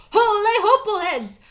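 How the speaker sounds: loud high holler; shouting in shock as they land. A narrator's reading. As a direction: cry out in alarm. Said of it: "hollered Scapito".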